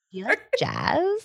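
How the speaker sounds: high, playful come-on; flirty voice. Pretend. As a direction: lay on the flirt with a laugh. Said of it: "is this my flirty voice".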